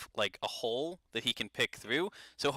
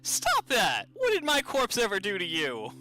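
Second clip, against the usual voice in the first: higher, louder indignant ghost protest; annoyed ghost; 7 semitones higher, 3 dB louder than usual. Acted. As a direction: scold him like an offended spirit. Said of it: "and he goes".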